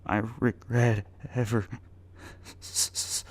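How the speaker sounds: choked, stuttering confession; tearful stammer. A narrator's reading. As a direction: stammer it through tears.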